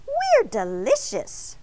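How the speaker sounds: high, drawn-out proud call; gleeful high boast; shouted back. A narrator's reading. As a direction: sing it out, stretching the key word. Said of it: "the donuts shouted back".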